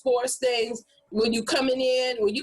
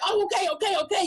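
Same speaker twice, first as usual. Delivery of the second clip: excited crowd; 9 semitones higher, much faster than usual. Retold or imitated. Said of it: "now they're like".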